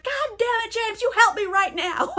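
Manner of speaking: high-pitched exasperated retort; hammed-up high, distressed scolding; upset, mock demand. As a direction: imitate an upset woman demanding help. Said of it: "She's really upset".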